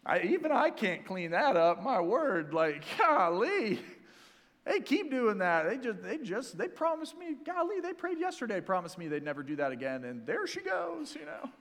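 a hint of a grumbling, let-down God; exasperated God. Preacher parodying a disappointed God, mildly high and fed up. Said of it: "God looks at and goes".

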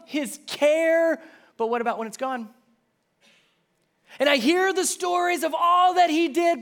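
high, loud doubting cry; mock worried believer. Believers doubting Jesus, loud high mock worry. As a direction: play the fretful believer.

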